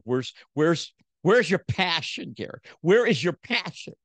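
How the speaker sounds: a wife demanding where his passion went; wife pleading. Retold or imitated. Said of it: "and she goes".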